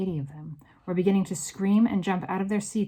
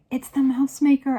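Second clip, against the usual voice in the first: higher, louder cry of alarm; excited cry; 8 semitones higher, 9 dB louder than usual. A narrator's reading. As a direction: cry out the discovery. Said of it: "I cried".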